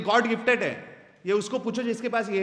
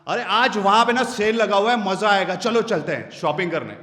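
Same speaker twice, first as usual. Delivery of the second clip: louder, excited invite; energetic loud demo; 5 dB louder than usual. Shown as a demonstration. Speaker demos volume up for energy, louder and excited. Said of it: "volume को up करते हैं".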